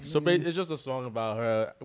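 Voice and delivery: ordinary talk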